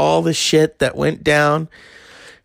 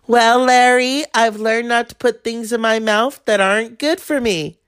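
Lewinsky; 7 semitones higher than usual. Retold or imitated. Lewinsky quoted to Larry King.

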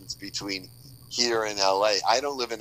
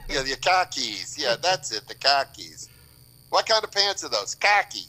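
put-on Boston accent; 4 semitones higher than usual. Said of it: "Boston accent bit".

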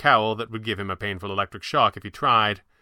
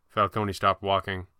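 usual speaking voice

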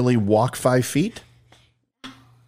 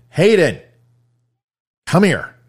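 a loud call, higher and louder; 7 semitones higher, 4 dB louder than usual. Real, not acted. Dad calling his daughter into the room, loud call.

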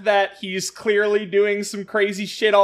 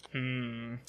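ordinary delivery

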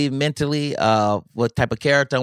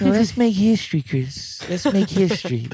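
[name] voice; 7 semitones higher than usual. Retold or imitated. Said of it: "He would say stuff like that".

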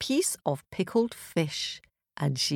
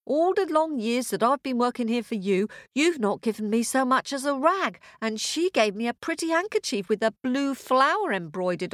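higher-pitched, indignant servant; aggrieved maid; 6 semitones higher than usual. A narrator's reading. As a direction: complain back as the overworked maid. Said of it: "And the maid said".

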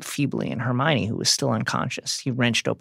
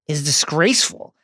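ordinary talk, nothing marked

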